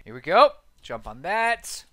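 usual speaking voice

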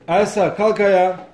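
ordinary talk, nothing marked

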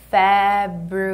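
"February" slow, each syllable held long. Shown as a demonstration. Teacher drilling February, syllable by syllable. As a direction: stretch "February" into separate level syllables.

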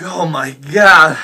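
slow, loud, drawn-out exclamation; overwhelmed, stretched-out wail; distressed outburst. Acted. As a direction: let it swell into a loud cry of dismay. Overwhelmed alone after the scare.

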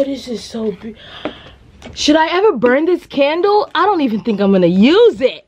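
ordinary delivery

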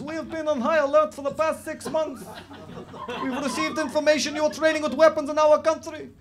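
put-on Eastern European accent. Fake cop addressing the stag party, Eastern European accent. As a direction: bellow orders in a broad mock Eastern European accent. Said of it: "this is my Eastern European accent".

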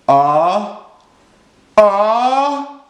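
rising "ah, ah" before a sneeze; sneeze build-up. A narrator's reading. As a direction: gasp upward as if about to sneeze.

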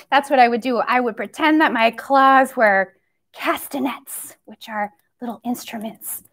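ordinary talk, nothing marked

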